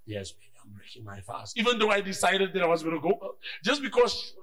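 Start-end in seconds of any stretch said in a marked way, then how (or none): 0.1-1.6 low, soft loving answer; low soft sweet-talk; sweet husband reply. Retold or imitated. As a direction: answer tenderly. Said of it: "husband answers wife sweetly".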